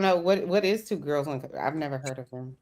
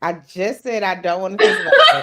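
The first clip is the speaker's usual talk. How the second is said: laughing loud protest; 2 semitones higher, 10 dB louder than usual. Real, not acted. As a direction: laugh through the complaint. Laughing protest at gross topic.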